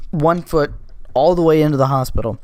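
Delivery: ordinary delivery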